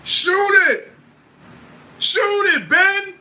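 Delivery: high-pitched shouted plea, loud; imitating Kyrie yelling at his teammate to shoot. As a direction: yell it like a teammate begging him to shoot. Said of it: "Kyrie Irving just yelled at Ben Simmons".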